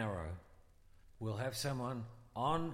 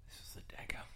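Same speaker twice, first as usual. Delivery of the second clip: ominous whisper; 10 dB quieter, faster than usual. Acted. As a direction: breathe it like a secret. Ominous aside.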